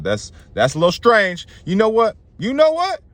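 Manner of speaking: climbing to a high-pitched outburst; mounting suspicion. Acted.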